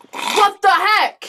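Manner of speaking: high, slow stunned gasp; shocked. Acted. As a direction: drag it out in disbelief. Shocked at transformation.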